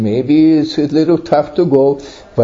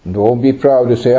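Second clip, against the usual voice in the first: ordinary talk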